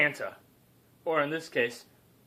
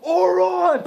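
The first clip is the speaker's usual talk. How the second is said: hero's battle cry, much higher and louder; battle shout; 10 dB louder than usual. Acted. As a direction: yell it like a hero charging in. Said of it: "Santa comes out and says".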